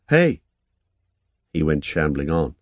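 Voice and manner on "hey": high call across the distance, higher and louder; sharp, high hey to someone walking off. A narrator's reading. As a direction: call after him to stop. Calling after him as he leaves.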